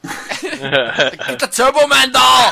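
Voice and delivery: high, slow, loud shout. Pretend. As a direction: belt the line out laughing. Shouting famous movie line.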